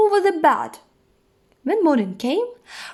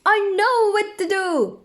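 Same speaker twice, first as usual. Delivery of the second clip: high, bright-eyed bird voice piping up; high-pitched storybook bird; bird character; 8 semitones higher than usual. A narrator's reading. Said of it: "said the bird".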